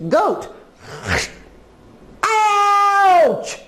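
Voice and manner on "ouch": high, long-drawn acted yelp; pained cry. Goat bitten by the ant, high acted cry of pain. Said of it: "cried Goat".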